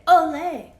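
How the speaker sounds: loud, high festive shout; cheer. Acted. Rooster celebrating the salsa to the barnyard, loud high cheer. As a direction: whoop it out like a proud rooster.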